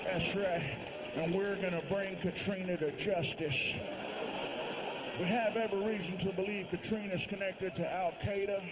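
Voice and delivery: a hint of a folksy president; Bush impression. President answering reporters about Katrina, lightly folksy. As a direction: play the clueless president.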